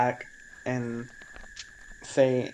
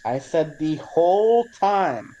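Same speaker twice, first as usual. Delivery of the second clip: loud, slow, higher-pitched reply; calling out louder; 5 semitones higher, 7 dB louder, slower than usual. Acted. Answering the kobold who didn't hear, calling out slowly. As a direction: stretch each word for someone hard of hearing.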